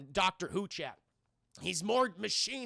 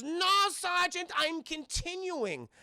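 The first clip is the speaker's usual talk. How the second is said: put-on much higher, slow, menacing German accent; hammed-up German mad scientist accent; evil German scientist accent; 7 semitones higher than usual. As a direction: sneer it as a cartoon German villain. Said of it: "stereotypical evil German Nazi scientist".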